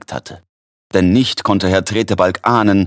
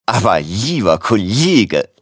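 protesting slowly through a sneeze; modest, drawn-out, about to sneeze; bescheiden niesend; slower than usual. A narrator's reading. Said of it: "bescheiden niesend". (bescheiden niesend)

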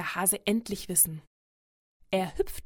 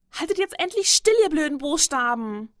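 loud, high-pitched exasperated shout; frustrated child's outburst, high and loud; angry scolding; 7 semitones higher, 8 dB louder than usual. A narrator's reading. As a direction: yell furiously at the stubborn letters. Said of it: "schimpft er laut".

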